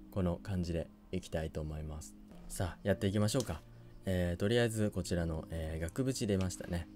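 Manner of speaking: low, soft, subdued voice; toned-down commentary. Real, not acted. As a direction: drop pitch and energy to spare the throat. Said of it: "ちょっと声のトーンと、あの、テンションと、あれ落とします".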